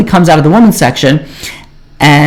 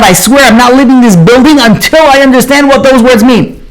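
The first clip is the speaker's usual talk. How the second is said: blurted out; 8 semitones higher than usual. Retold or imitated. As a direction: blurt it out as if it escapes you. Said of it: "he blurts out".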